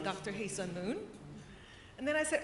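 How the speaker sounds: ordinary delivery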